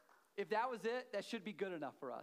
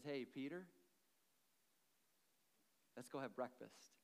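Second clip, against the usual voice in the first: gracious invitation; 6 semitones lower, 7 dB quieter than usual. Acted. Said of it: "Jesus, in his grace, says".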